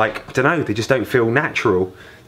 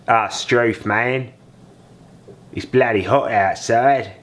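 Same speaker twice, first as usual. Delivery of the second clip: slow, hammed-up mock Australian accent; mock Australian drawl, "Ah, Struth" stretched out; much slower than usual. Shown as a demonstration. Dad showing off his Australian accent to viewers, hammed-up. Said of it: "put on my Australian accent".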